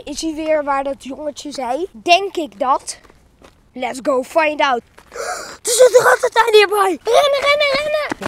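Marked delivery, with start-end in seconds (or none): 5.6-8.3 high screaming run, run; hammed-up panicked shriek; mock panic. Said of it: "Nee, grapje".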